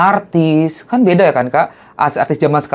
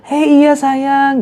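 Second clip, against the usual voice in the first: sweet greeting; 12 semitones higher, much slower than usual. Retold or imitated. Anissa Bahar greeting fan.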